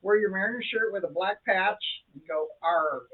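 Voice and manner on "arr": a hint of a pirate "arr"; playful mock pirate exclamation; pirate growl. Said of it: "Where's your Pirates shirt".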